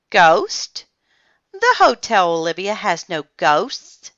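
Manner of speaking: loud, emphatic rebuttal; firm denial. A narrator's reading. Olivia to scared guests, firm denial. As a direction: scoff and set them straight. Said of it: "Olivia answered firmly".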